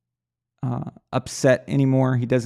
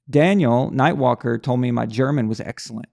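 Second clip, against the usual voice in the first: ordinary talk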